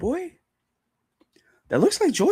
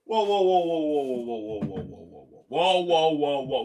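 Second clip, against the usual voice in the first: stunned whoa; 3 dB quieter than usual. Real, not acted. Overwhelmed by the scene.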